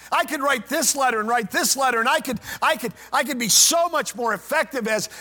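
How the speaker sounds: loud, high, desperate pleading to God; Paul's pleading prayer. Acted. Paul begging God to heal his blindness, pleading loudly.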